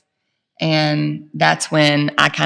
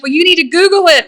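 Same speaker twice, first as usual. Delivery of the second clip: high, loud and fast; mock-frantic husband, high and quick; frantic suggestion; 11 semitones higher, 5 dB louder, faster than usual. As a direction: blurt it out in a rush. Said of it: "And he's like".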